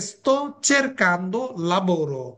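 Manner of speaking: sto cercando lavoro spelled out word by word; very slow model phrase. Shown as a demonstration. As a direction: dictate the phrase slowly to learners. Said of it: "আপনি বলতে পারেন".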